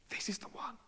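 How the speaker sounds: hushed, breathless whisper of recognition; awed whisper. Acted. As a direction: breathe it out in awe.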